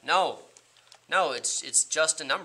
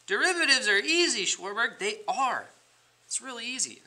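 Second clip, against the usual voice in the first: high mock-cheery; 9 semitones higher than usual. Teacher mock-cheery reassurance.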